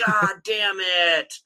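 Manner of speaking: dragged-out, slow grumble; slow defeated groan. Retold or imitated. Collector dad groans slowly as son opens the box. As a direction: let it sag out slowly in defeat. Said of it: "I'm like".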